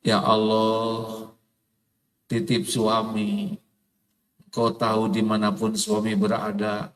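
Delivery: a lightly voiced wife praying, low and half-sung at the start; mock wife's prayer. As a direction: pray like a fed-up wife, mostly in his own voice.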